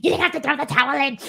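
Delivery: rapid, strained outburst imitating her rage; hammed-up rapid-fire tantrum; mock angry snap. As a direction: act out her sudden fit, words tumbling out fast. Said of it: "goes from zero to a hundred and snaps".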